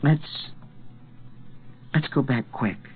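softly beg, voice thin and hesitant; whispered plea. A narrator's reading. Trembling boy urging gunslinger to turn back, whispered plea. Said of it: "Jake whispered".

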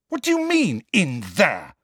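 very high, sharp challenge; indignant high outburst falling on "in there"; angry demand. A narrator's reading. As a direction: demand an explanation, voice shooting up. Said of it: "sounding much angrier than".

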